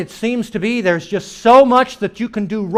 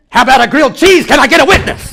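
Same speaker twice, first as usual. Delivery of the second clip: mock preacher; 5 semitones higher, 7 dB louder, faster than usual. Mocking churchy talk about food.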